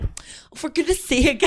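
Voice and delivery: imitated motherly exasperation, high and loud; mock exasperated mum. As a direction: act out a fed-up mum. Mum reacts to armpit hair.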